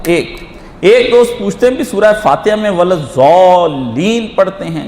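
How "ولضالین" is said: ولضالین drawn out very slowly with a full ضاد; recited ضاد. Shown as a demonstration. A preacher answers a friend's question, reciting ولضالین slowly. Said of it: "یہ ضاد ہے یا دعاد ہے".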